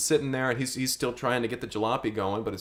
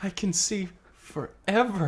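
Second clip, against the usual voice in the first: slow awed; 6 semitones higher, 4 dB quieter, slower than usual. Acted. Tripping father in awe.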